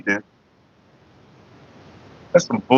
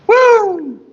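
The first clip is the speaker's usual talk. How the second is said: high celebratory woo. Real, not acted. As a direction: whoop it out up high. Celebrating tasty hit.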